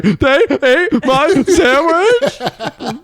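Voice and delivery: high, loud, slow laughing whine; mock whine, laughing. To his mom on the phone, laughing mock whine. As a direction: whine like a wronged kid.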